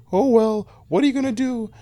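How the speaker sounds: put-on high resigned old-lady sigh. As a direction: sigh it out high like a shrugging granny. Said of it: "sighed and went".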